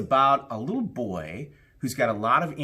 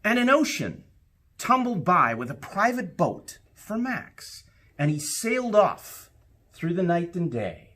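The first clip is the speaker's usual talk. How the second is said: put-on lively read-aloud for children, rising with the adventure; storybook narration; 4 semitones higher than usual. A narrator's reading. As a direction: tell it like a bedtime story to a class. Reads picture book aloud.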